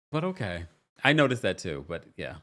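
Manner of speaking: ordinary delivery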